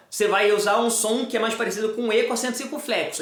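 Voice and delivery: ordinary talk, nothing marked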